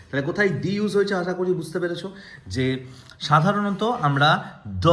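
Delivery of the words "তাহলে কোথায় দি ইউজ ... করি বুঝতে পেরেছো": ordinary talk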